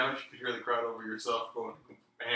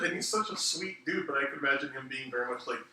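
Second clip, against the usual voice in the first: ordinary delivery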